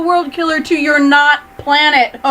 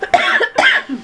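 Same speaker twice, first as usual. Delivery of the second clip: put-on old hag cackle; 12 semitones higher than usual. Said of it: "witch laugh".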